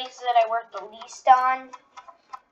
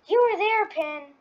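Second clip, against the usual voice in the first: quoting a character; 9 semitones higher, slower than usual. Acted. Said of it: "I guess that's not as good".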